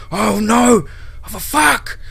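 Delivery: very high, frantic screaming tirade; yelling into phone. A narrator's reading. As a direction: scream in panicked outrage down the phone. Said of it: "Brady yelled into the phone".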